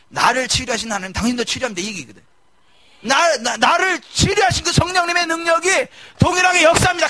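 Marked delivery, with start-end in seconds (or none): 3.0-7.1 full-force proclamation. Acted. Preacher declaring with full force.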